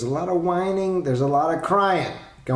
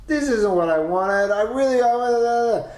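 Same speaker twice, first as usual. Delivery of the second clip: mock whiny kid; 9 semitones higher, slower than usual. Parent voicing complaining kid.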